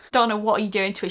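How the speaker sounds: ordinary talk, nothing marked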